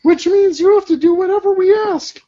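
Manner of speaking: put-on high girl voice. Voicing teen character.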